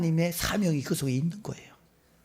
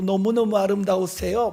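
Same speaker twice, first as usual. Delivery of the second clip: higher-pitched, smiling compliment; cheerful gushing; 6 semitones higher, 3 dB louder than usual. Retold or imitated. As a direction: imitate a friendly boy's eager praise. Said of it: "밝게 웃으면서 인사를".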